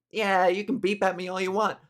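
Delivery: usual speaking voice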